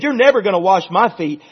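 emphatic mock protest; bold refusal. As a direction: play a stubborn disciple objecting outright. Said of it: "that's a bold statement".

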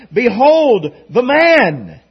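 loud, slow proclamation; proclaiming. Acted. Pilate presenting Jesus to the mob, loud and slow. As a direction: announce it slowly to the whole crowd.

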